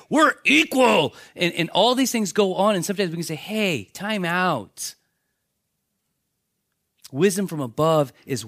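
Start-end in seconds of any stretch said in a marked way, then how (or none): 0.1-1.1 mock demand. Mocking people demanding rights. 3.4-4.9 slow time out. Retold or imitated. Calling a halt in an argument.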